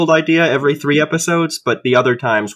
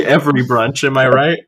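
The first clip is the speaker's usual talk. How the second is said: ordinary delivery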